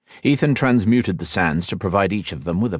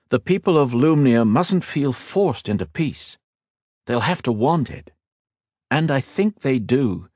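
higher, earnest and steady; earnest hero voice; 6 semitones higher than usual. A narrator's reading. Hero reassures his eager cousin, earnest and steady. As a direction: promise him, then lay out the mission. Ethan explains.

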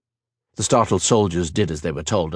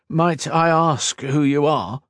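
hesitant, slow question pitched higher; nervous; 7 semitones higher, slower than usual. A narrator's reading. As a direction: ask timidly, as if wary of a superior. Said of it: "the captain asked nervously".

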